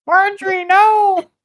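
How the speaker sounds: very high, loud goblin cry; squealed creature protest; panicking goblins. Acted. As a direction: plead like a terrified goblin. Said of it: "all the goblins look to Marjorie".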